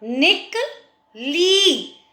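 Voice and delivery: "निकली" slowly, short "निक", pause, long "ली"; syllable by syllable. Shown as a demonstration. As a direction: break up "निकली" and stretch the last ee. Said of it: "ल में बड़ी की मात्रा".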